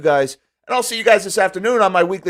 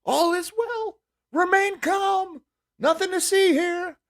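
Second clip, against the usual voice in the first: much higher, slow, falsetto mock calm; mock tech-speak reassurance; slower than usual. Mocking crypto exchange excuses, sing-song high falsetto calm. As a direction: parody a soothing corporate robot, drawn out. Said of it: "That is tech speak for".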